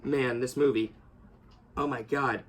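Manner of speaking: fighting back tears over the death scene; getting emotional. Real, not acted. Reviewer recalling Logan's death, voice dropping, near tears. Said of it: "I'm about to get emotional again".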